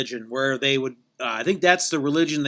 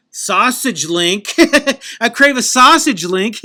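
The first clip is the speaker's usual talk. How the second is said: loud, slow, higher goofy cry about sausage; goofy punchline; 8 semitones higher, 11 dB louder, slower than usual. Acted. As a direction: belt the sausage line out like a hungry goof. Goofy punchline on missing link.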